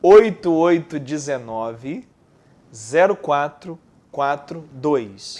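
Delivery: slow, each digit spaced apart; staccato. Shown as a demonstration. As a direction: read the digits one by one, slowly. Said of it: "Pô, pausadamente".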